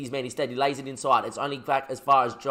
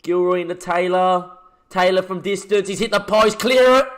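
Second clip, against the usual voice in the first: panicked commentary; 4 semitones higher, 6 dB louder than usual. Real, not acted. Near concede, panicked commentary.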